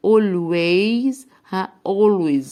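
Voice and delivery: 'always' opening on 'ol', ending in a Z sound; loud, careful teacher's model of 'always'; modelled pronunciation. Shown as a demonstration. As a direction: say 'always' as 'OL-wayz', clearly for learners. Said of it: "ال A لما يجي وراها L بنطقها O".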